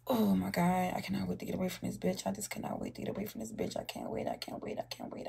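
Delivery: whispered muttering, getting fainter. Real, not acted. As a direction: whisper under the breath, fast then fading. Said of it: "muttered to self".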